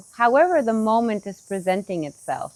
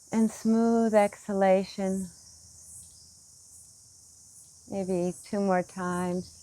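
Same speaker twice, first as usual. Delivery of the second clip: guided meditation; 4 dB quieter than usual. Acted. Teacher leads meditators through smooth breaths, soft guided meditation. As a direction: lead the breath gently, leaving long pauses.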